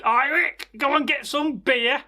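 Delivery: calling out high across the castle; a mock shout up the stairs; hammed-up high call. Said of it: "somebody shouted".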